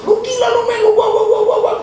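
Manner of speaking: high, loud mock tirade; hammed-up scolding that turns into babble; raging wife. Wife keeps berating her husband, high mock fury. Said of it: "ngamuk istrinya, dia dimarahin".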